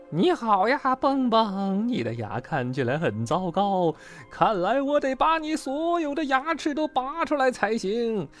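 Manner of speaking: creepy high-voiced villain, ending in a cackle; sinister nightmare dentist. A narrator's reading. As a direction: toy with the frightened patient. Nightmare dentist threatens Bengbeng.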